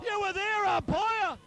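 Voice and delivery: high, loud outraged call at the umpire; yelling at umpire. Real, not acted. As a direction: protest hard at the umpire's call.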